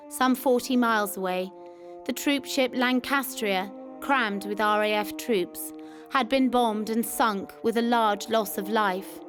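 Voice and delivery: ordinary delivery, nothing marked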